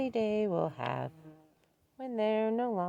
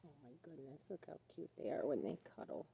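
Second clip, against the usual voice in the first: quiet; 5 semitones lower, 17 dB quieter, faster than usual. Real, not acted. Hushed watching cuddling animals.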